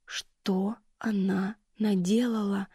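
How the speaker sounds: очень медленный беззвучный шёпот; выдохни шёпотом, растягивая; медленный испуганный шёпот. Acted. Ужас, шепчет себе. (дочь повторяет медленным шёпотом)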